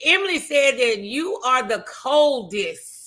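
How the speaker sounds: ordinary talk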